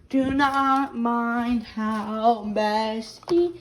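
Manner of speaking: tired voice after a bad night; real low sick voice. Real, not acted. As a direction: drop to her own rough voice. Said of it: "my voice sounds like this right now".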